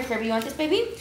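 ordinary delivery

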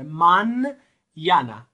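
"من یانا" said slowly in two parts, "ma" then "nyana"; spelling out the sound. Shown as a demonstration. Teacher breaks down ñ for students: slow "من یانا". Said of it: "فکر کنید دنبال ان یه یه هم وجود داره".

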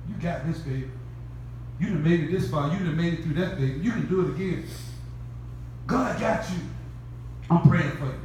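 low, soft, gentle reassurance; tender comfort. Retold or imitated. Preacher voicing a friend comforting someone struggling, soft and low. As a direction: comfort them like a loved one.

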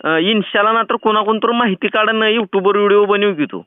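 usual speaking voice

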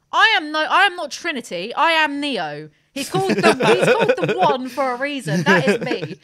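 hammed-up outrage at being called Trinity; protesting loudly in mock indignation; mock fury. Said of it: "I, I was furious".

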